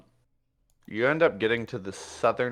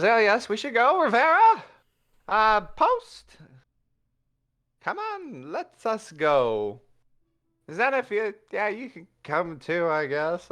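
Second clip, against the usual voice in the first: high, loud boastful voice; vain cat hero; 9 semitones higher, 4 dB louder than usual. Acted. Puss Sparrow rallying the group, high and pompous. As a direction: lord it over the party.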